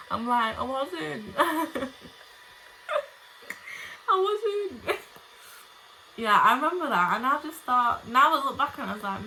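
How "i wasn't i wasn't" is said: high, mock-tearful denial through giggles; laughing protest. Retelling a child's denial to dad, high laughing protest. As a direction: squeak out a child's excuse while laughing.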